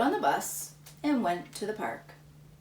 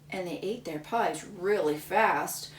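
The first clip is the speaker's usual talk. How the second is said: usual speaking voice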